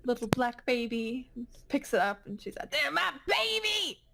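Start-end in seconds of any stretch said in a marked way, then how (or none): 2.7-4.0 high-pitched, emphatic claim; insistent false mother. Acted. Jane claims a baby.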